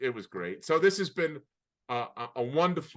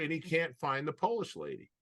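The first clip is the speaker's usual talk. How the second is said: usual speaking voice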